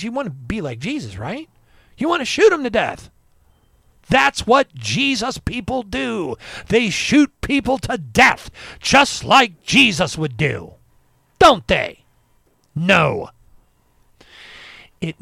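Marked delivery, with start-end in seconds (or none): 2.0-13.3 loud, slow, mocking scorn; sarcastic outrage. Real, not acted. Ranting at armed church folk, loud sarcastic scorn. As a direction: jeer at gun-toting churchgoers.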